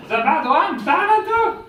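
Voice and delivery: big, loud and slow showman reveal; grand magic reveal. Acted. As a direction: stretch it out like a magician unveiling the trick.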